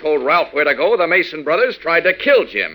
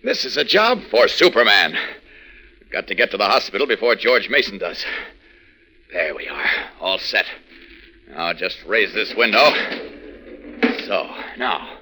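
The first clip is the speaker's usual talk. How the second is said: low and firm, a heroic baritone, well lower than Clark; Superman's heroic voice; 8 semitones lower than usual. Acted. Clark becomes Superman and speaks low and heroic. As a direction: drop into the hero's voice for the change.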